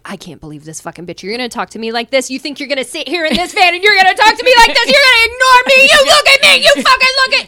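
from a low mutter rising to a high furious yell; building scream. Retold or imitated. Rain blowing up at the housemates in the van, building rage. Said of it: "starts low... it's like a progression".

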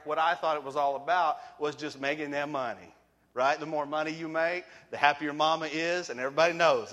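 normal talking voice

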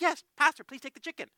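high-pitched, earnest offering; gracious offer. Retold or imitated. Woman offers her only chicken to the pastor, graciously. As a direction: imitate a generous host, starting loud and easing off. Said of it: "she is so gracious".

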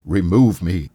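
loud, urgent cry of remove me; loud exclamation. A narrator's reading. Scrooge to the ghost, a loud urgent cry. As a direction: cry out to be taken away. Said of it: "Scrooge exclaimed".

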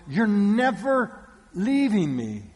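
loud, slow, high-pitched insistence; desperate clinging. Acted. Mary holding on to the risen Jesus, desperate clinging. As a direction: hold on hard and refuse to let go. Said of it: "holding on to this man going".

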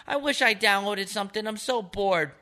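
put-on high, whiny old woman complaining; whiny old lady. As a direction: pitch it up and whine like the bored old lady. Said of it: "She goes".

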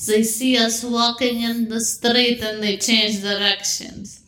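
put-on measured Arabic-accented host speech. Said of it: "we're gonna do the Arabic accent".

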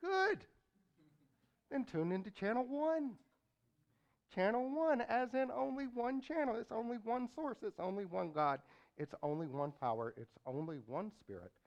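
high, loud preaching that winds down; booming motivational speech. Acted. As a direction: preach it big, then settle. Preacher builds to booming climax.

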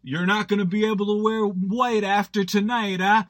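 a hint of the drunk best man ribbing the bride; best man teasing the bride Liz at a wedding, mildly leering; crude best man jab. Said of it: "He was like".

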